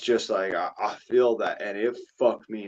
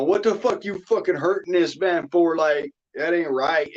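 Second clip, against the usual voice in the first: higher-pitched, indignant challenge; retold indignant scolding; 6 semitones higher, 3 dB louder than usual. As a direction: replay cussing people out on someone's behalf. Replaying his cussing out of people.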